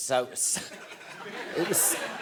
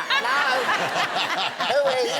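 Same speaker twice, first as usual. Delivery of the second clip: high, loud mock-incredulous squeal; high-pitched disbelief; 4 dB louder than usual. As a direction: call out in exaggerated bewilderment, voice leaping up. Mock reaction to speech at table.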